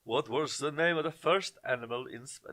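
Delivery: a weak, hammed-up Russian accent on the quiz question; bad Russian accent. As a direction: put on a clumsy Russian accent, barely there. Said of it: "that was the worst Russian accent ever".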